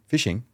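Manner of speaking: "Fishing?" said alone as a curious one-word question, pitch a little up; echoed as a question. Shown as a demonstration. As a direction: toss "Fishing?" back as a gentle question. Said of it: "the last word of your sentence with a question mark attached".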